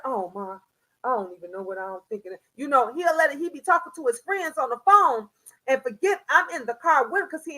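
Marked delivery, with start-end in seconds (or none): 0.1-2.3 lower, softer, fast sheepish son; imitating her son. Son apologizing to his mother for cursing. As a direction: mumble a quick sheepish excuse.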